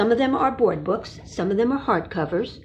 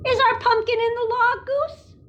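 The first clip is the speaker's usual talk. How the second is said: higher, fast Duck asking; higher duckling question; 14 semitones higher, 3 dB louder than usual. Acted. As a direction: chirp it as an eager duck. Duck asks in story.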